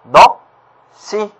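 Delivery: 넋, 시 split in two, the 시 tensed to 씨; tense-consonant demo. Shown as a demonstration. Teacher shows students the tensed sound, syllable by syllable. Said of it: "된 소리로 발음한다고 합니다".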